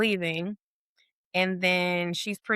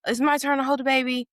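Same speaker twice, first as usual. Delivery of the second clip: higher, louder pushy quote; acted insistence; 7 semitones higher, 4 dB louder than usual. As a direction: imitate someone insisting on a turn. Acted demand to hold baby.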